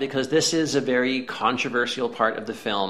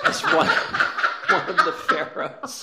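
much higher, breathless laughing words; 14 semitones higher, slower than usual. Real, not acted. Laughing at Turturro casting.

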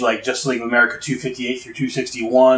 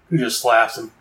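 ordinary talk

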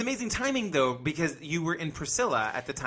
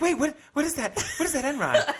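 confused; 12 semitones higher, 4 dB louder than usual. Retold or imitated. Confused people misreading sarcasm.

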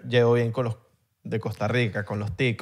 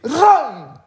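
much higher mock shout; pedido desesperado; 10 semitones higher, 3 dB louder than usual. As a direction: shout the order high over the noise. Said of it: "Y yo señalaba". (ron gritado muy agudo)

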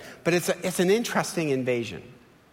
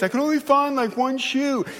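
put-on high-pitched kid's excuse; a hint of a child's voice, pitched up; lightly voiced kid; 8 semitones higher than usual. Kid missing a shoe to dad, lightly voiced child.

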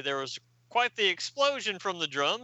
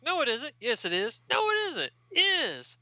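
high-pitched childish bickering, acted; mock squabble; 10 semitones higher than usual. Host acts out villains arguing, hammed-up bickering. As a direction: jump up high on each contradiction.

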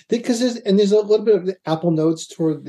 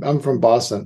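ordinary talk, nothing marked

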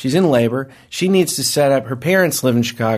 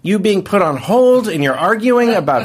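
higher, heated re-enacted scolding; retold yelling; 5 semitones higher than usual. Husband scolding wife on hold during birth, retold yelling. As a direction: imitate arguing in exasperation. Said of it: "I'm yelling at her".

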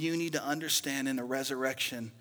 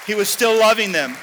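loud preacher's cry, raised high; preaching shout; 6 semitones higher, 17 dB louder than usual. Real, not acted. Preacher shouting to his church, full-voiced cry. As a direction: belt it out to the congregation. Preacher repeats phrase loudly.

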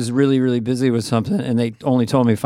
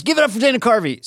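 high hyped intro call; big enthusiastic crowd-hype shout; excited announcer; 9 semitones higher than usual. Retold or imitated. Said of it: "and he goes".

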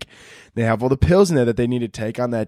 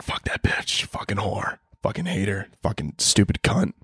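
hushed, lower, under-the-breath cursing; muttered insults; 5 semitones lower, 5 dB quieter than usual. Retold or imitated. Cousin hearing great aunt has covid, hushed swearing. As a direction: mutter the insults so no one hears. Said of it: "you're like".

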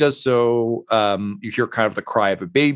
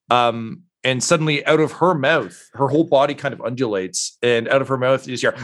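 ordinary delivery

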